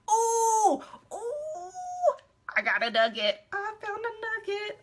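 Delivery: high, delighted squeal of discovery; oh, I found one, gleefully; excited squeal. Real, not acted. Excited at finding a favorite old message. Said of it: "excited at finding a message".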